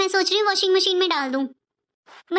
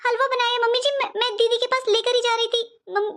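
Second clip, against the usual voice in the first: usual speaking voice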